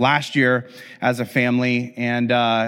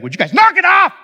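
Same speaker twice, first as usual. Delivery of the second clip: much higher, loud exasperated yell; a mother snapping at fighting boys; mom's exasperated yell at the boys; frustrated shout; 13 semitones higher, 5 dB louder, faster than usual. Retold or imitated. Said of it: "boiling over with frustration".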